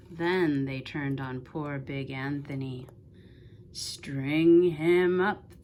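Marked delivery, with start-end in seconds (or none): 3.7-5.6 loud, slow, heavy angry shout. A narrator's reading. The town's men turn on Big Anthony, slow loud shout. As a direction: drag each word out like a mob demand. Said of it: "the men of the town shouted".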